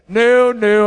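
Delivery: firm refusal. Retold or imitated. Husband refusing wife fixing his toe, loud refusal. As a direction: shut her offer down fast.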